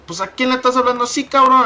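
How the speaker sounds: high, fast Spanish challenge from dad; dad questioning. Retold or imitated. Dad asks son who he is insulting, high fast Spanish. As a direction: demand it like a suspicious father. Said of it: "he was looking at me like".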